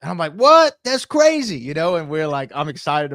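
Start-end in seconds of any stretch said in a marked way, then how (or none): 0.3-1.6 yelped what, much higher and louder; stunned disbelieving yelp; shocked. Retold or imitated. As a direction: blurt it out in total disbelief. Retold reaction to news.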